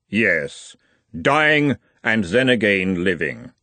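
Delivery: slow, unearthly Death; grim reaper voice. Acted. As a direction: speak as Death, unhurried. Death answers Nutt.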